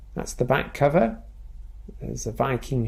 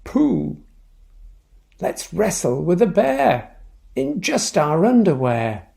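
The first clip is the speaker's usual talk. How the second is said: high mock holler at the start; a playful storybook holler; 5 semitones higher, 6 dB louder than usual. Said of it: "But Harold hollered".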